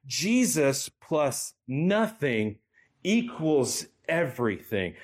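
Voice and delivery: very slow, each word weighed, "Jesus" stressed; said again slowly. Shown as a demonstration. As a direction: drive it home by slowing right down. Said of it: "I'm gonna say that again".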